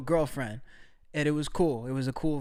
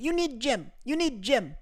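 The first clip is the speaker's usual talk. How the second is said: higher, insistent broken English; broken English insistence; 6 semitones higher than usual. Retold or imitated. Imitating girlfriend insisting in broken English, higher and blunt. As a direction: repeat it like a blunt fact. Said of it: "She goes".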